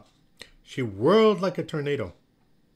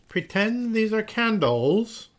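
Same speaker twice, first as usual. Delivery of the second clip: high, loud, slow teacher's direction; teacher's instruction; 9 semitones higher, 6 dB louder, slower than usual. A narrator's reading. Miss Olga handing spoons to the trees, projected instruction. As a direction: project it slowly to a whole class.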